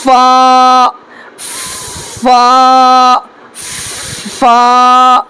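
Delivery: فا with the teeth lightly on the lip; careful model of فا; letter demo. Shown as a demonstration. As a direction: say فا carefully, spaced out. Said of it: "جیسے".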